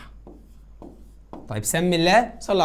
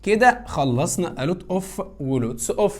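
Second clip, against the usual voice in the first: slow summary; much slower than usual. Shown as a demonstration. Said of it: "wrapping up lesson".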